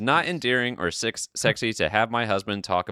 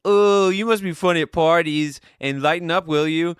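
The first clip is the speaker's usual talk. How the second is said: much higher, louder sarcastic jab; mocking taunt; 9 semitones higher, 7 dB louder than usual. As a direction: needle her like a sarcastic husband. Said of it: "He started saying".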